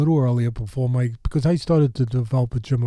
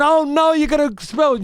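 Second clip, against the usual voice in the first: mock yell, pitched way up; Camille; 14 semitones higher than usual. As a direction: imitate a woman yelling in alarm. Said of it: "Camille's yelling".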